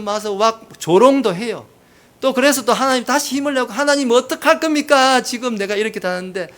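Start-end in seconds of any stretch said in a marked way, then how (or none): 3.7-6.5 high-pitched complaint, easing off; imitated resentful prayer; acted reproach to God. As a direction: plead in frustration to God. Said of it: "원망도 해봅니다".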